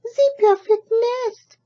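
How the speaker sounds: high, slow, loud duck voice; a duck squealing with delight. Acted. Duck admires the nest, high and loud. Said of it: "she cried".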